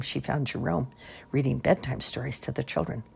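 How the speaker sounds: ordinary delivery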